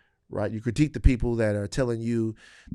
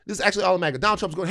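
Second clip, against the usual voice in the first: ordinary talk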